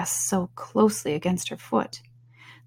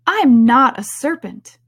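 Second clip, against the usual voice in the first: higher, louder indignant denial; Alice, indignant; 5 semitones higher, 7 dB louder than usual. A narrator's reading. Alice denying the pigeon's accusation, indignant. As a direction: protest it with affronted force. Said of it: "said Alice indignantly".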